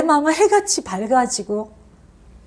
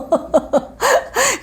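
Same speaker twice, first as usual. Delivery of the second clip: a sudden high laugh breaking through; high burst of laughter; 10 semitones higher than usual. Real, not acted. Preacher laughing at her own marriage story, high laugh. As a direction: laugh out at the memory.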